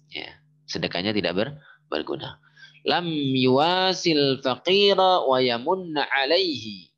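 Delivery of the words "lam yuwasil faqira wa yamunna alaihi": slow, slightly louder reading to repeat after; slow recitation for repeating. Shown as a demonstration. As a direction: read it out slowly so students can follow. (membaca pelan agar murid mengikuti)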